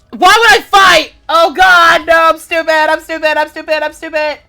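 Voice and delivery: loud, high panicked wailing; panicked shouting. Real, not acted. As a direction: freak out, yelling at yourself. Panicked after running from monster.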